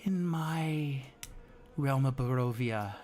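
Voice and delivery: languid villain with long, deliberate pauses; unhurried, measured villain monologue; calm, collected villain. Acted. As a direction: draw out each phrase with composed menace. Plays the villain in the mirror.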